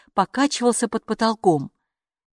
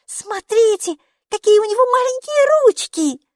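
put-on very high, louder child voice; little girl's amazement; 6 dB louder than usual. A narrator's reading. Maiki gasps at the creature's hands, high amazed squeal. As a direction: marvel in a tiny girl's high pitch. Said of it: "ахнула Майки".

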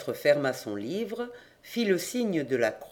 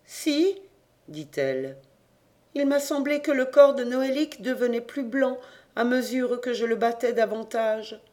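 a hint of a higher, awed mother; lightly voiced mother; 6 semitones higher than usual. A narrator's reading. Grida voiced by narrator. (voix de mère légèrement aiguë)

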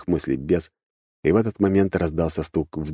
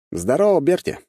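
high, cheery hail to a friend; 10 semitones higher than usual. A narrator's reading. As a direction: greet your pal brightly, pitch leaping up. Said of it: "бодро выпрыгнул я из машины". (бодрое приветствие)

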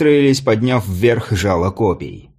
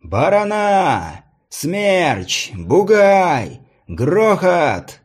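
high, slow, drawn-out announcer call; herald calling out; 7 semitones higher, much slower than usual. A narrator's reading. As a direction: stretch each name out across the arena. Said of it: "голос глашатая принялся перечислять имена".

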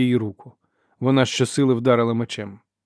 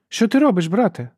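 high, loud questioning call; 10 semitones higher, 5 dB louder than usual. A narrator's reading. Sister tracking Ferro calls to her brother. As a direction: shout the question across to him.

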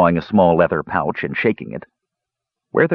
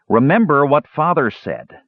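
higher, firm warning; stern; 6 semitones higher than usual. A narrator's reading. Tannen warning his brothers, stern. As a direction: lay down the law. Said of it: "Tannen's tone grew stern".

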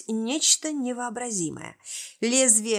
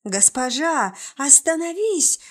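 loud, higher, terrified cry; frightened plea; 5 semitones higher, 5 dB louder than usual. A narrator's reading. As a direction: shout in fear for mercy. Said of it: "В испуге рухнули на колени".

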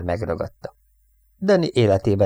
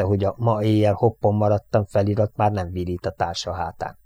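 usual speaking voice

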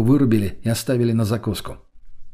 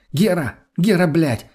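higher-pitched exclamation, a mild yell; crying out; 6 semitones higher, 3 dB louder than usual. A narrator's reading. Lena calling to her lover across the house, mildly shouted. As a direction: call his name out in excitement. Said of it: "закричала она чуть не на весь дом".

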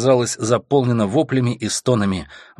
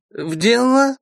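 put-on drunk with hiccups; 14 semitones higher, 4 dB louder, slower than usual. Drunk viscount mumbles.

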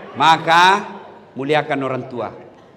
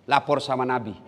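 ordinary talk

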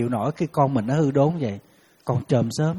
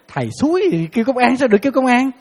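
higher and louder, in an alarmed rush; frantic caller; 8 semitones higher, 6 dB louder than usual. Retold or imitated. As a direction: sound like a panicking mother on the phone. Mother's frantic outburst retold.

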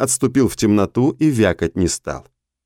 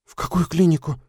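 higher, scared interruption; frightened; 9 semitones higher than usual. A narrator's reading. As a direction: cut in, frightened. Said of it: "испуганно перебила Таша". (испуганно)

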